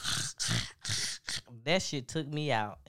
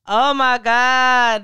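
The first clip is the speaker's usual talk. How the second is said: excited; 7 semitones higher, 12 dB louder, slower than usual. Real, not acted. Cracking up after the clip.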